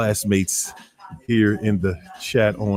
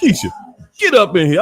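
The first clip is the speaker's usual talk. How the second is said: hype call-in; 7 semitones higher, faster than usual. Shown as a demonstration. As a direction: call her in like a hype host. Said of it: "That's how you gotta talk to Keisha".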